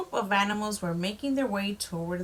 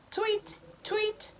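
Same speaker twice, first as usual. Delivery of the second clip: high chirpy birdsong voice; a bird tweeting to spread the alarm; bird call; 13 semitones higher than usual. A narrator's reading. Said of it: "the bird cried".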